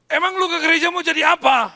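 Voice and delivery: loud, high mocking shout; friends shouting. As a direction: yell the question up high, taunting. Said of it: "teman-teman teriak". (teriakan mengejek yang keras dan tinggi)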